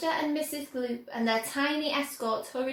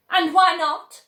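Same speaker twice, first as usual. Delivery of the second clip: louder, a sharp shrieked question; 5 semitones higher, 10 dB louder than usual. A narrator's reading. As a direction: demand it shrilly. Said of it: "shrieked Missus Gloop".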